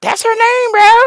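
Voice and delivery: high, loud incredulous rant; exasperated disbelief. Real, not acted. Fumbling [name]'s real name, high and incredulous. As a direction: shriek with laughing disbelief.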